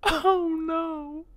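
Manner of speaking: very high falsetto wail; mock crying woman. Girlfriend crying on TV.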